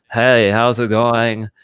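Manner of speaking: slow, drawn-out mock American accent. Foreign colleagues asked to sound American, exaggerated American accent. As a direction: imitate a foreigner's uptight take on American speech. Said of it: "what does an American accent sound like".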